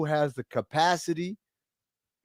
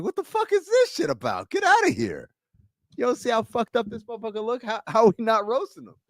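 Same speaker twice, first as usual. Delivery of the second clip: high-pitched mock outrage; indignant disbelief; 7 semitones higher than usual. Himself reacting to positive comments, mock indignant. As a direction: complain in a raised, incredulous voice.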